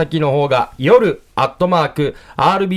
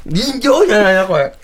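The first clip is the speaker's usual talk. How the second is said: laughing through the words; cracking up while talking; 5 semitones higher than usual. Real, not acted. Laughing after sour candy.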